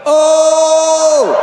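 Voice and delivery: loud high excited oh; excited gasp. Retold or imitated. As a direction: gasp with glee. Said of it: "I was like".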